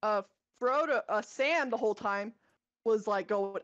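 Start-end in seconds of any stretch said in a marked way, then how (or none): none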